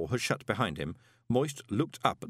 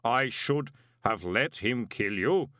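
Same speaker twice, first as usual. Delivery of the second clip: slow, measured inhuman voice; deadpan golem; 2 semitones higher, 3 dB louder, slower than usual. A narrator's reading. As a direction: say it evenly, word by word. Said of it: "said the golem".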